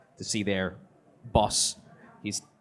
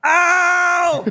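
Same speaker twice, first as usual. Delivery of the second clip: pained yelp; 9 dB louder than usual. Real, not acted.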